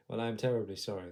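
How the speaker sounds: doctor hurrying past an awkward moment; rushed apology. Acted. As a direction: gabble the apology out.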